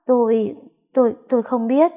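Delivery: stumbling, fearful stammer on Tôi, tôi; a maid caught out, stammering. Acted. Maid stammers to her master, mild fearful stammer. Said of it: "Thấy chị ấp úng".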